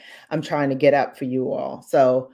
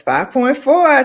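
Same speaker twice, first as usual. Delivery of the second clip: excited; 10 semitones higher, 8 dB louder than usual. Real, not acted. Cheering the milestone goal.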